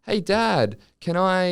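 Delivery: put-on high, eager kid voice; little boy voice. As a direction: ask like a small boy. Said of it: "the son is like".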